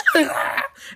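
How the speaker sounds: very high, loud comic shriek; hammed-up scream; mock screaming. Crowd refusing before the fight, mock screaming. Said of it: "they're, like, screaming".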